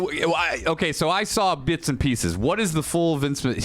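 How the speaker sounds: normal talking voice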